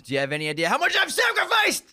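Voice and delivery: high, loud furious yell; furious shout. Acted. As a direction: scream it at the board. Acting Norman's angry outburst.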